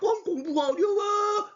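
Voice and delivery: high, drawn-out wail of complaint; mock complaining student. Teacher imitating a student struggling with crop science, mock wailing. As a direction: wail it like an overwhelmed student, hammed up. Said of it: "하는데".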